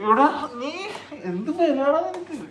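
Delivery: loud, high-pitched telling-off; exasperated scolding. Real, not acted. Adult scolding the child fussing over a haircut, exasperated.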